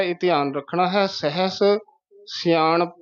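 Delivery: ordinary delivery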